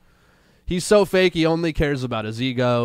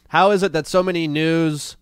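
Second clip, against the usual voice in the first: ordinary talk, nothing marked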